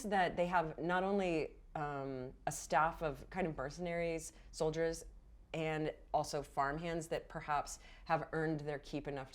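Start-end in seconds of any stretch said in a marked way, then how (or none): none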